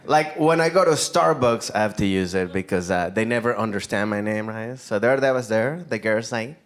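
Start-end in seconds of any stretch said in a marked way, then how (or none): none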